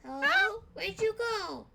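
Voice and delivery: put-on a lost kid looking around; small confused child calling out; Rad the kid. GM voices Rad searching.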